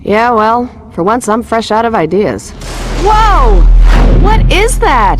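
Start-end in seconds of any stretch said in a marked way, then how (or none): 3.0-5.2 sudden high, loud cry of alarm; jolted by a shock she didn't see coming; startled gasp. Acted. Lois spots something strange, startled and loud.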